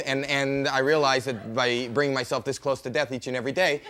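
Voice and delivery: ordinary talk, nothing marked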